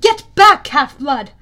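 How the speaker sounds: commanding shout. A narrator's reading. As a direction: order him back in battle. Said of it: "the girl with the circlet said".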